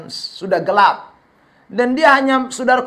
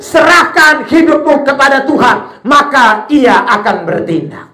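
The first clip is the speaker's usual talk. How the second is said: loud, high-pitched sermon climax; fiery preacher shouting his call; preaching at full force; 9 semitones higher, 8 dB louder than usual. Acted. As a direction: drive the appeal home to the congregation.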